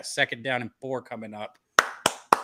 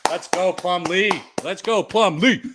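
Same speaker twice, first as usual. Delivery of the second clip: drawn-out loud cheer; slow rallying chant; 3 semitones higher, 6 dB louder, slower than usual. Real, not acted. Cheering on quarterback.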